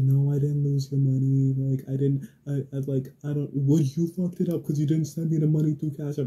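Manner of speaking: loud, high, defensive protest; imitated yelling. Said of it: "who the fuck you're yelling at".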